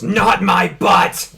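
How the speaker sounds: slow, loud outraged shout. Acted. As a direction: bellow it slowly. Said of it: "Undyne shouts".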